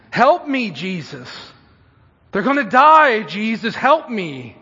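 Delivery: loud, high plea of a frantic parent; urgent cry for help; desperate yelling. Acted. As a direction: beg at full voice, dropping on each name. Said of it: "and he yells out".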